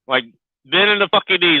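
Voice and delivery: usual speaking voice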